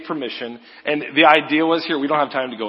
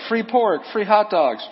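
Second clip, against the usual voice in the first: high, louder joking call of free food; mock herdsmen calling out; 8 semitones higher, 3 dB louder than usual. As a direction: hawk it like a vendor shouting to the crowd.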